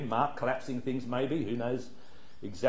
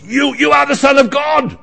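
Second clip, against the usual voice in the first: high, loud shriek of a cornered demon; demon's cry; 13 semitones higher, 15 dB louder than usual. Acted. Demon shrieking at Jesus, high and loud. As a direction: cry it out as the possessed one, shrill and defiant. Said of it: "what the demon says is".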